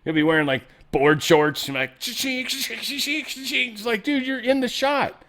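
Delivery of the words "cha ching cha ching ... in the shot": high falsetto skit voice; high-pitched comic imitation; squeaky acted voices. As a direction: act out a camera and crew, pitched way up. Acting out cameraman on the moon.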